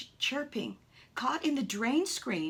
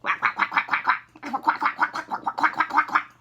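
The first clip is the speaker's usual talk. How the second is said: loud, rapid duck quacks; mother duck quacking loudly at the golfer; 6 semitones higher, 10 dB louder than usual. Acted. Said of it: "She was quacking very loud".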